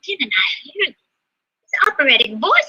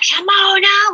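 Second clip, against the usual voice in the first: calling out name; 10 semitones higher, 4 dB louder, slower than usual. Retold or imitated. Family calling her out by name.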